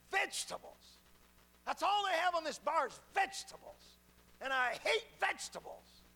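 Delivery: put-on high, loud, disgusted old woman's voice; cranky old lady. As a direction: complain like a grumpy little old lady. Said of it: "she said it just like this".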